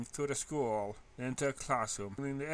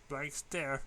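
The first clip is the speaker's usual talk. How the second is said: ordinary delivery, nothing marked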